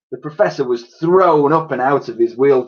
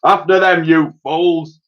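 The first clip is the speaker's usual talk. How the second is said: louder, higher snarling order; snarled; 6 semitones higher, 5 dB louder than usual. Acted. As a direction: bark at the useless men. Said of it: "snarled Parker".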